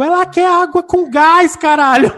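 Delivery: loud, high exasperated retort; exasperated manager. Retold or imitated. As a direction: snap back at a clueless coworker. (gerente irritada, alto)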